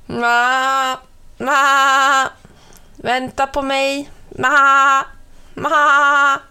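a little lamb bleating after its mother. A narrator's reading. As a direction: bleat it like a lamb calling mum. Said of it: "bräkte ett litet lamm".

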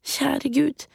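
put-on high, pleading girl's voice; pleading prayer. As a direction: pray desperately as a little girl. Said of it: "ba hun".

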